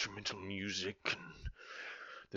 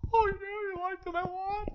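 high dude voice. Pretend. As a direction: answer like a squeaky buddy. Second dude in dialogue.

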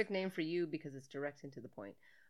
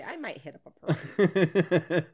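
louder and slow, hammed-up scorn; mocking quote; 12 dB louder, slower than usual. Host mocking the answer her co-host skipped, slow and louder. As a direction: drag it out as if it's the lamest answer. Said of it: "God, I'm glad you didn't say".

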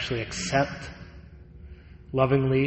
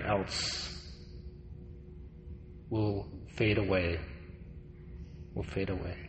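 fading quiet; 4 semitones lower, 10 dB quieter than usual. Acted. Repeated fading words.

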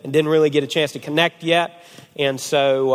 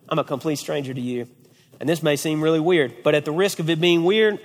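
ordinary talk

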